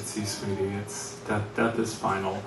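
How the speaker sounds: soft, low comforting voice; gentle comforting. Retold or imitated. Dad comforting his crying daughter, soft and low. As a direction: break it to her gently. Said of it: "I went over to comfort her".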